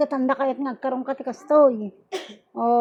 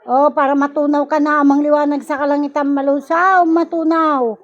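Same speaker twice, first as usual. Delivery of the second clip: loud, commanding rebuke of a spirit; healer's stern banishing chant; forceful exorcism commands; 2 semitones higher, 5 dB louder than usual. Acted. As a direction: drive the demon out with each word. Casts out evil spirit, commanding.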